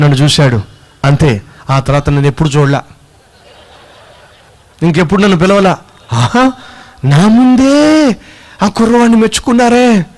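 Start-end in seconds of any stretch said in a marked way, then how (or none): 6.1-10.1 jealous indignation. Acted. Slighted pastor hearing a young preacher praised, jealous. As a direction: stretch it out like a wounded rival.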